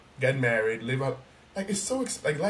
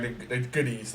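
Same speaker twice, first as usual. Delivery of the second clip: usual speaking voice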